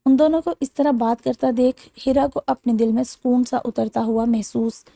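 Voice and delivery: plain reading voice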